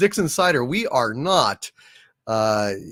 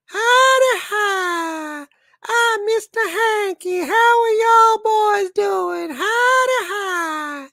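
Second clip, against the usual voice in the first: squeaky, very high falsetto cartoon greeting; extremely high, hooting cartoon character; Mister Hanky; slower than usual. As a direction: pipe it out in a piercing high Southern cartoon voice. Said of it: "Mister Hanky is".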